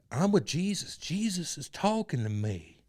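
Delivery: loud, slow preacher drawl; cult leader impression. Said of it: "impersonation of him?".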